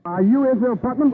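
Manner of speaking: high, loud astonished question. Acted. Rider realises the shepherd is Putnam, astonished. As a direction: blurt it in disbelief.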